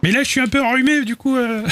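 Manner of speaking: very high falsetto character voice; Tutler. Acted. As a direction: squeak it out far above your own pitch. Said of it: "Il fait la voix de Tutler". (voix de personnage suraiguë)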